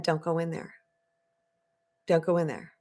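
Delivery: soft, neutral, calm warning; quiet inner voice gently warning. Retold or imitated. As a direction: say it softly and evenly, like a calm inner voice. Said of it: "It was calm, it was quiet, it was neutral".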